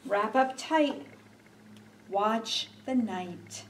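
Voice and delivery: put-on reading to children. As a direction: read gently to little ones. Reads a picture book aloud.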